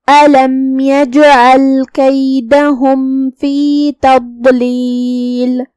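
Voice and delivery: slow, measured tajweed chanting; slow melodic Quran recitation. Shown as a demonstration. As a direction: draw out each vowel, melodic recitation. Teacher recites Quran verses.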